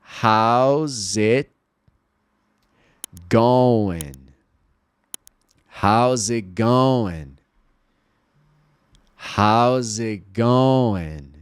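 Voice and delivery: How's it going drawn out very slowly with pauses; slow repeat-after-me. Shown as a demonstration. Teacher leading students in repeating aloud. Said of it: "fala em voz alta comigo".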